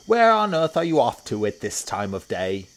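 loud, high hippo questioning; Humpy Rumpy. A narrator's reading. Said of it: "said Humpy Rumpy".

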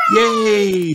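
loud high cheer of delight. Acted. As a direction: shout for joy, children cheering. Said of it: "cheer Peppa and George".

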